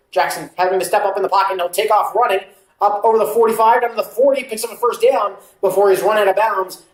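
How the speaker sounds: football call lifting as the runner breaks free; live play-by-play. Acted. Calling a quarterback run for viewers, play-by-play. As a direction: call the scramble, building gradually.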